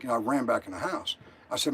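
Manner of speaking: ordinary talk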